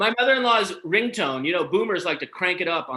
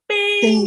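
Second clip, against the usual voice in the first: high ding; 7 dB louder than usual. Retold or imitated. Imitating a phone ding.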